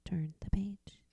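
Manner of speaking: soft whispered page cue. Real, not acted. Reader whispers to the listener to turn the page.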